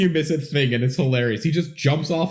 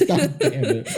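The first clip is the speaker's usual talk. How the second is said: high laugh into laughing curse; real laughter; laughing hard; 3 dB quieter than usual. Real, not acted. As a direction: crack up, then curse through it. Caught out by the joke.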